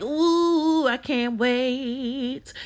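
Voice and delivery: high, drawn-out ooh; excited squeal. Retold or imitated. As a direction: gush like you can't wait. Excited about food.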